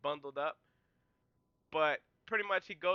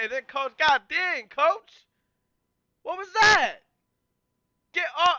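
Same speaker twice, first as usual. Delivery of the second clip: much higher, louder God dang, Coach; incredulous yelling; 9 semitones higher, 12 dB louder than usual. Real, not acted. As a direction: shout in disbelief at the coach. Mock outrage at celebrating coach.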